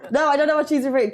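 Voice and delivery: ordinary talk, nothing marked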